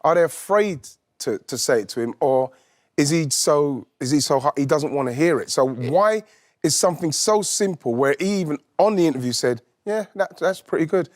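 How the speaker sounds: normal talking voice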